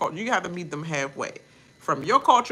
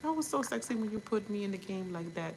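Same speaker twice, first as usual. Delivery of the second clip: higher, softer mock-seductive voice; mock flirty Amaya; 8 semitones higher, 11 dB quieter than usual. Amaya sweet-talking Brian on the side, higher and softer, mock-flirty. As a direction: coo it like she's flirting, hushed and high. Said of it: "so that she can be like".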